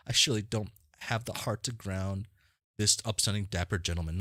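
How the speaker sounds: ordinary talk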